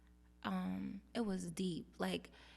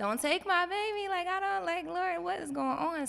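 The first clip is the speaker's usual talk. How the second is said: higher, louder pleading to the Lord; desperate prayer; 9 semitones higher, 6 dB louder than usual. Retold or imitated. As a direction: beg God not to take her child. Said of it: "it was like".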